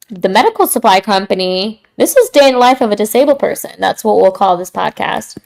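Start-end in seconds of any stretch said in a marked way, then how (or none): none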